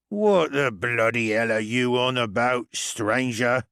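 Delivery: put-on slow, drunken slur; a sloshed dwarf slurring his challenge; slurred. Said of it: "slurred one dwarf".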